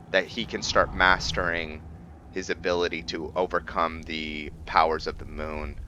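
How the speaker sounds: ordinary delivery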